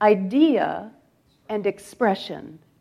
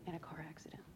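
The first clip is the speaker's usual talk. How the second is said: barely voiced, heavy with grief; hushed whisper; 6 semitones lower, 28 dB quieter, faster than usual. Real, not acted. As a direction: drop to a near whisper on the loss. Grief in family tragedy story.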